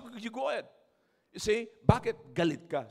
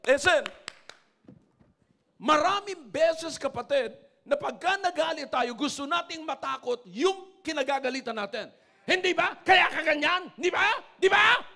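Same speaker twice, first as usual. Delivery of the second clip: loud, higher preaching, building to shouts; fiery preaching; 7 semitones higher, 7 dB louder than usual. Acted. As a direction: press the congregation hard. Preacher rising to full force.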